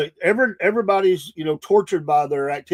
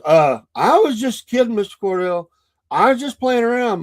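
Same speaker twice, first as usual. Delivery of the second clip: higher-pitched, mock sheepish excuse; retold nervous excuse, pitched up; backpedaling kid; 6 semitones higher, 4 dB louder than usual. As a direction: imitate a kid hastily backing down. Kid backs down to angry dad.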